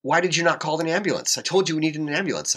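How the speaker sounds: high-pitched indignant outburst; retold anger. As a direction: replay the scolding, pitch way up. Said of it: "I was pissed".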